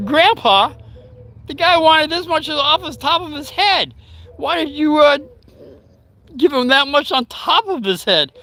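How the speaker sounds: put-on high, protesting little-kid voice; child's voice. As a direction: imitate a child's high-pitched complaint. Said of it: "as a child, I'm saying".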